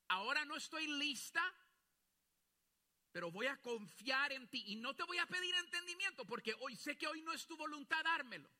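loud, high, impassioned prayer building to a cry; preaching at full force. Acted. Pastor praying aloud before his congregation, impassioned loud preaching. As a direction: pour it out to God, full voice.

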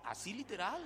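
ordinary talk

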